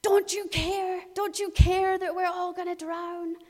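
high, loud, desperate plea; panicked cry. Acted. Disciples crying out to Jesus in the storm, panicked. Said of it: "you can hear it in their voice".

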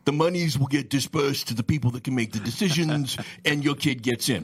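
light mock mafioso accent, half-joking; a hint of a New York mobster pitching a deal. Lawyer jokingly voicing a shady college fixer in a mafioso accent. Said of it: "a mafioso accent or whatever".

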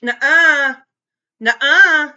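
real loud disagreement with a co-host; loud protest. Real, not acted. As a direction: shut the co-host down firmly. Arguing with co-host over dates.

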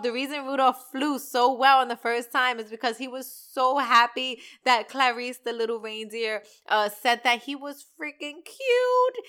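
normal talking voice

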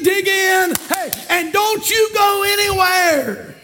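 impassioned preacher climbing to a high cry; full-force preaching. Real, not acted. Preacher exhorts Christians to dig in, building shout.

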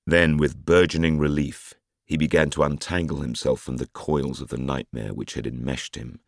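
ordinary delivery

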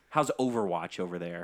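ordinary talk, nothing marked

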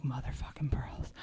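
a disgusted whisper at the mirror; whispered curse. Retold or imitated. Muttering at her own reflection in a whisper. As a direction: hiss it under the breath.

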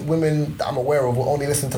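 normal talking voice

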